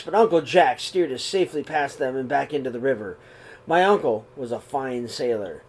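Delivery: calm, even read-aloud voice; steady storybook telling; story narration. A narrator's reading. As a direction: read the story gently to the kids. Reads picture book aloud.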